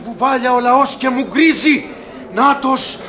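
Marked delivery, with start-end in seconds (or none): 2.3-3.0 building from a call to a high cry; each repeat higher and more urgent; rising excitement. Acted. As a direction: townsman spotting the Duke, growing frantic. Crowd spots the Duke arriving.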